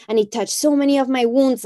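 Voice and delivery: usual speaking voice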